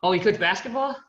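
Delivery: a plane seatmate assuming she coaches basketball, mock surprise; mock passenger question. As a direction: play the presumptuous seatmate. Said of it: "you start talking".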